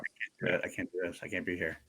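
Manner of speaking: very soft, low acted-out line; overwhelmed quote. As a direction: imitate someone quietly backing out, voice dropped. Voicing character who must walk out.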